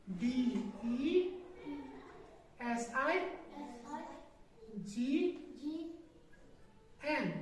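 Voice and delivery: ordinary delivery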